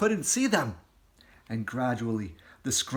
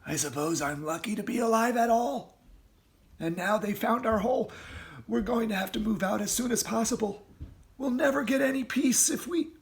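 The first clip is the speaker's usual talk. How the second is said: Mister Fox thinking; 9 semitones higher than usual. Acted. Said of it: "he thought".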